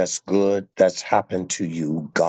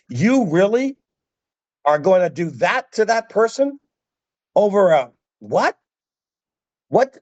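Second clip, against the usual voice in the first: king's outraged rebuke; 12 semitones higher than usual. Retold or imitated. King rebuking the forgiven servant, outraged shout. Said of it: "he's like".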